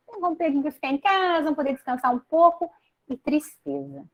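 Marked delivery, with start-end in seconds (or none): 0.1-2.7 higher and fast, gushing imitation; fast mock delight. People excited to rest at home, fast higher gushing imitation. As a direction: rattle it off like an eager holiday-maker. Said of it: "muitas pessoas dizendo".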